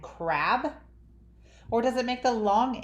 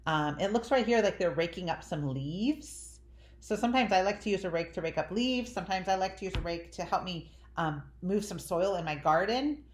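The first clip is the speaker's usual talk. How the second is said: usual speaking voice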